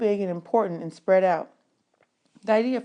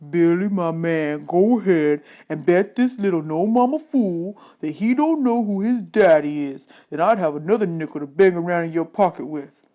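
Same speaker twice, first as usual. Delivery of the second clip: a hint of a talking buffalo, slowed and a bit louder; deep buffalo voice; 4 dB louder than usual. A narrator's reading. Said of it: "It had a deep voice like you'd figure a buffalo would".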